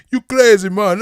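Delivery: loud, very high put-on Jamaican accent; mock Jamaican accent. Old station man to penny-paying customer, loud mock Jamaican accent. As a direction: exclaim it in an exaggerated Jamaican accent. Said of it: "he said in his Jamaican accent".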